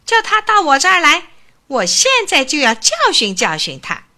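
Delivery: high, loud indignant squeak; boastful mouse shouting back at his aunt; squeaking outburst. A narrator's reading. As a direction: squeal it out like a puffed-up little mouse. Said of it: "老鼠吱吱地叫了起来".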